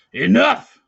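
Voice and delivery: sudden loud high bellow; shout. Acted. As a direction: cut the boy off with a yell. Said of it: "he shouted".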